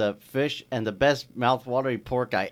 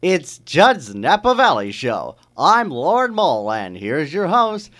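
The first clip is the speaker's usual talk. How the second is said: announcer; 6 semitones higher, 9 dB louder, slower than usual. Acted. Host introduction.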